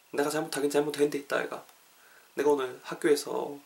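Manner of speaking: soft, subdued admission of fault; dejected confession. Acted. Student admits a mistake at school, dejected and soft. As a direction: own up quietly, a little downcast.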